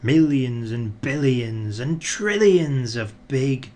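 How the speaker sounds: ordinary delivery, nothing marked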